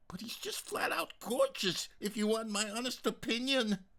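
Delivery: gushing. Acted. Daughter gushing about boyfriend.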